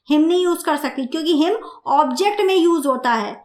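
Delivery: ordinary talk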